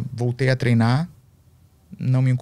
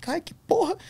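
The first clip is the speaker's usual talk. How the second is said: much higher, sudden frightened yelp; retold scare, yelped high; startled yelp; 5 dB quieter than usual. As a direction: jump out of your skin. Seeing ghostly figures.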